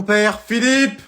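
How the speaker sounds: high, loud call for her husband. Acted. Mum calls Philippe to come, loud and high. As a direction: call him from across the house.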